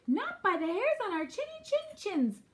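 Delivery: high, defiant little pig; shouting piglet. A narrator's reading. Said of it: "shouted Percy".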